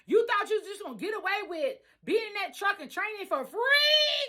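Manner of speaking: high, heated scolding of the couple; calling them out for taking her money; angry confrontation. Retold or imitated. Telling off the Joneses for using her truck, angry.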